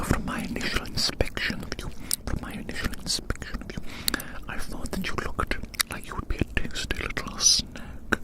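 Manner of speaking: usual speaking voice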